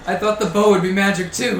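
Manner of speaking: defensive archer. Acted. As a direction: shout back as a cornered archer. Archer shouts back at player.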